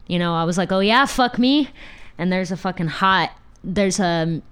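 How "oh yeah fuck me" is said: sarcastic retort. Retold or imitated.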